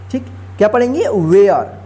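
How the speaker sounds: ordinary talk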